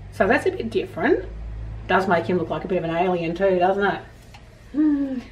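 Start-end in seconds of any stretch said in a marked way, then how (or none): none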